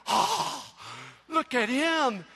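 high gasp, then drawn-out disbelief; slow, high-pitched wonder; retold awestruck amazement. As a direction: play a kid losing his mind over a star. Retold child awestruck by [name].

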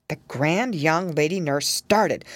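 plain reading voice

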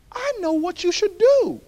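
put-on high, hushed oracle-like old lady; lightly voiced wise old neighbor; old woman.

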